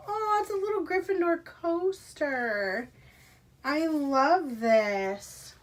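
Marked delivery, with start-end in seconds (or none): none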